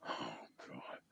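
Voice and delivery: breathy whispered exasperation under the breath; whispered oh god. Real, not acted. Interviewer reacts to a dodged age question, whispering. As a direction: mutter it to yourself, barely voiced.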